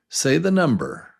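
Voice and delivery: ordinary talk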